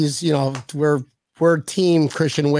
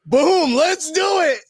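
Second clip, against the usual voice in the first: excited; 11 semitones higher than usual. Acted. Host voicing a fan who mastered the culture, high excited whoop. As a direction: stretch it out high like a hyped fan.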